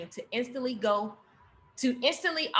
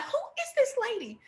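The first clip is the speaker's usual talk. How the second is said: surprised; 11 semitones higher than usual. Retold or imitated. Reacting to the transformed aunt.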